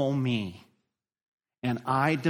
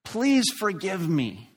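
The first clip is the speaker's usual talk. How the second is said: begging; 6 semitones higher, 3 dB louder than usual. Acted. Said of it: "just begs the master".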